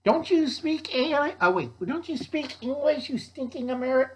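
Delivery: high-pitched, scolding alien. Acted. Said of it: "aliens going".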